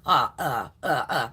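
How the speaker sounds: low, halting stammer; mock stuttering. Boss caught out by the notice question, mock stuttering. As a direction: imitate someone lost for an answer. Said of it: "you keep stuttering, motherfucker".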